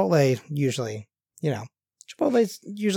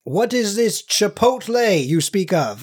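slow, higher and grandly puzzled; mock-archaic pomp; medieval time traveler; 5 semitones higher, slower than usual. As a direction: play a baffled knight out of his time.

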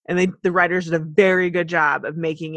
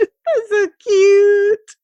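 gushing; 11 semitones higher, 8 dB louder, much slower than usual. Real, not acted. Gushing over the scene.

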